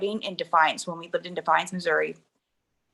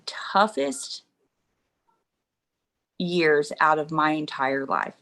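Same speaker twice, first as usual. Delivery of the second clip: slow, halting, heavy pauses; subdued grief; 3 semitones lower than usual. Real, not acted. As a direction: struggle to get the words out. Asked about life after his death.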